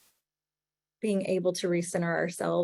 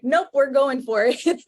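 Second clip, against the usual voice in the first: higher and louder, playfully bold; defiant emotional mind; 7 semitones higher, 9 dB louder than usual. Retold or imitated. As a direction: toss it off like a reckless inner voice. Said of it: "your emotional mind is like".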